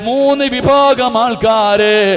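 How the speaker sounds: loud, chanting sermon cry with long-drawn endings; impassioned preaching. Acted. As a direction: preach at full force, stretching the last word of each phrase. Preaches at full force. (ഉച്ചത്തിൽ ഈണത്തിലുള്ള വികാരഭരിത പ്രസംഗം)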